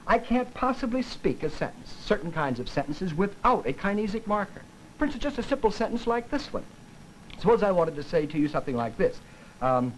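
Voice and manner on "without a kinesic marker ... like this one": ordinary delivery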